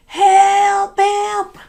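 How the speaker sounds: high, loud cry for help; girl stuck by the water shouting high and loud for help. Acted. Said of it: "She began to shout for help".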